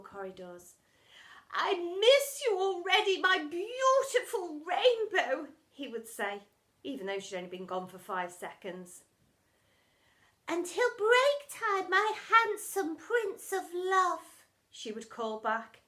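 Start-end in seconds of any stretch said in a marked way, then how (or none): 1.5-5.5 much higher, louder, slow swooning call; lovesick calling out. Acted. Mister Lovey calling love to Miss Dovey, swooning and loud. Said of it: "calling out messages of love". 10.5-14.4 much higher, louder, slow swooning call; lovesick calling back. Acted. Miss Dovey calling back to Mister Lovey, swooning and loud. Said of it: "calling out messages of love".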